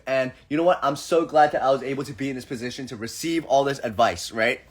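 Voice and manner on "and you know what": usual speaking voice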